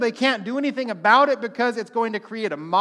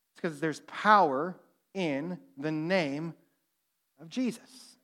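soft preaching emphasis; 5 semitones lower, 6 dB quieter, slower than usual. Acted. Preaching punchline softly.

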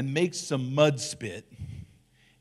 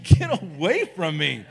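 raised, mock-disgusted push-away; mock recoil; 3 semitones higher, 6 dB louder than usual. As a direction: pretend to shoo the kid off, half joking. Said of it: "I'm like".

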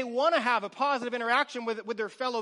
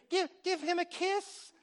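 much higher, sing-song coaxing; high cooing voice to a toddler; baby-talk coaxing; 9 semitones higher than usual. Retold or imitated. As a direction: coo at the babies like a parent. Parent coaxing babies to hug.

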